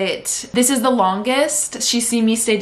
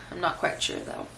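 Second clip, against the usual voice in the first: fading whisper; 10 dB quieter than usual. Real, not acted. Voice fades to near nothing.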